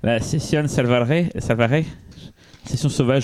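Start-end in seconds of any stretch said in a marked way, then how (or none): 0.0-1.8 slow, rolled Spanish pronunciation of the title; Spanish accent. Retold or imitated. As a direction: say it like a Spaniard, carefully. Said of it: "mon accent espagnol".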